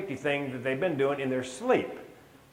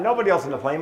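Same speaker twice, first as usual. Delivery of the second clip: ordinary talk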